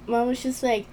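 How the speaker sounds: ordinary talk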